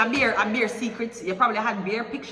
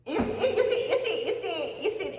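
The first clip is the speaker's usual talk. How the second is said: mocking impression; 12 semitones higher than usual. Mocking clients who visit her.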